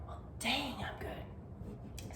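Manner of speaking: relieved little whisper. Pretend. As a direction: breathe it out as a whisper. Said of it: "you're gonna be like".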